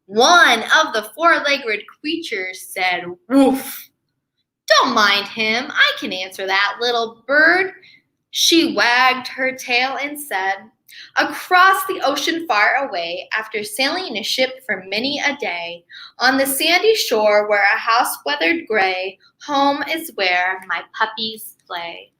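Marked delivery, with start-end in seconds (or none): 3.3-3.9 dog bark. Acted. Dog barks. 4.7-22.1 low dog voice. Acted. Dog answers in rhyme.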